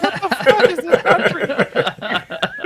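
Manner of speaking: laughing, slow and high; exasperated laugh through the words; laughing disbelief. Retold or imitated.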